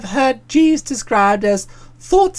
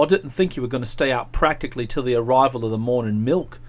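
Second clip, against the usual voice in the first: low, subdued scolding voice; low-pitched, dry reproof; disapproving friend; 11 semitones lower, 5 dB quieter than usual. Acted. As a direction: lecture him in a low register.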